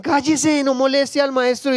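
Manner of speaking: high acted rebuke; scolding hush. Preacher voices the crowd silencing the blind man, scolding. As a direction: order the noisy beggar to be silent. Crowd hushing the shouting beggar.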